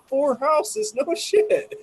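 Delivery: very high squeal of amazement; amazed. Real, not acted. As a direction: shriek it in delighted disbelief. Stunned by smell distance.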